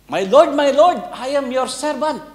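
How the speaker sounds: high, eager recital of "My Lord, my Lord, I am your servant"; practised humble greeting. Acted. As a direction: rehearse the servant line eagerly. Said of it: "practice siya". (masiglang pag-eensayo ng pagbati)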